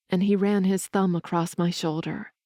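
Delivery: ordinary delivery, nothing marked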